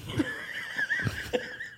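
high wheezing laugh; breathless wheezy giggle. Real, not acted. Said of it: "Sorry about my wheezing".

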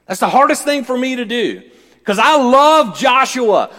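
high, loud, relentless pulpit cadence; loud proclamation with stretched key words; impassioned full-force preaching. Real, not acted. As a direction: build the refrain like a revival preacher.

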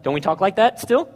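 usual speaking voice

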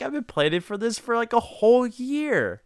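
high, fast, eager gush; overexcited gush. Retold or imitated. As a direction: blurt it out, too excited to hold back. Voight too excited to tell people.

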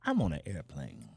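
very low, deadpan stage voice; imitating laid-back Ali. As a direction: deliver it deep and unhurried by any hype. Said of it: "he'll just be like".

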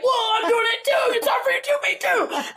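struggling through Pilates, squealing high; excited strain. Retold or imitated. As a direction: squeal it out mid-workout. Quoting herself mid-workout.

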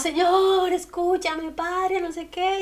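a hint of a frantic prayer; mock desperate plea. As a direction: play a desperate believer crying out to the Father. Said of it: "y grite, y llore, y patalee".

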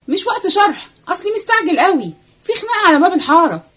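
put-on a breathless kid eager to run off; excited servant boy. Servant boy rushing to watch a fight, high and fast.

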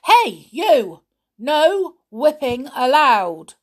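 loud, slow warning yell; angry repeat shout. Acted. Pixie in charge repeats the warning to Winks, slow loud shout. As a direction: call him out again, sterner. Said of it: "shouted the pixie in charge".

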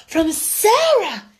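high, loud, drawn-out amazement; amazed schoolgirl cry; astonished exclamation. A narrator's reading. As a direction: exclaim the name in disbelief. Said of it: "everyone joined in exclamation".

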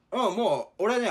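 usual speaking voice

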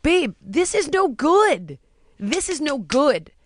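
imitated earnest plea, drawn out; emphatic warning. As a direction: spell it out with mild exasperation. Retells warning partner in a fight.

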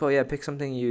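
ordinary delivery

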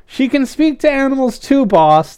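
high-pitched excited shout; squawked-up, high announcement. A narrator's reading. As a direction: call it out to the boss. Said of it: "the pigeon shouted".

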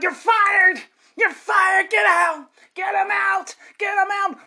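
furious shout. Acted. Boss firing the lawyer.

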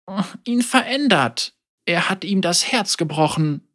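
a hint of a grieving elder speaking frankly; old woman Ying. Elder Ying tells Yasuo she holds a grudge, gently voiced.

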